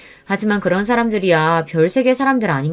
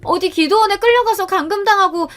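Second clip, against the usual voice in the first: put-on high, frantic begging young woman; desperate pleading; 13 semitones higher than usual. Daughter-in-law begging mother-in-law to keep secret, high frantic plea. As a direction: plead at a much higher pitch, near tears.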